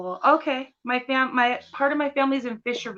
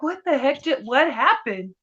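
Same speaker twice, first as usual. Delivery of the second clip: mock bafflement; 4 semitones higher, 7 dB louder than usual. Acts out baffled reaction.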